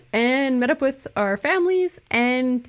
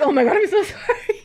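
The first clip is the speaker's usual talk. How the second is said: laughing apology; 12 semitones higher than usual. Retold or imitated. Laughing at her whispered vow.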